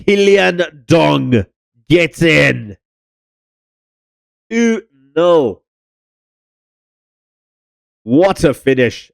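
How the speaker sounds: drawn-out, high goal call; excited goal call. Acted. Goal commentary celebration.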